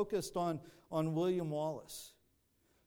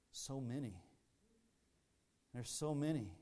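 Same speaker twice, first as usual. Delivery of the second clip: softer, defeated, almost to himself; weary dismay; 4 semitones lower, 6 dB quieter than usual. Retold or imitated. Weary soldier staring at the vast army, soft defeated voice. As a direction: let the count of the enemy sink in, softly. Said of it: "and he just like".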